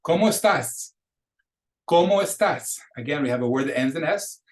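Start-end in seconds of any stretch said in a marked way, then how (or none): 0.0-2.8 ¿Cómo estás? much higher, clearly enunciated; model pronunciation. Shown as a demonstration. Teacher pronouncing words for students to repeat, ¿Cómo estás? as a high-pitched model. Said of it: "gonna pronounce those words for you".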